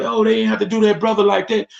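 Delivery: high, fast gossiping voice; mock gossip. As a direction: rattle it off high like a busybody. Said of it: "people up there talking about".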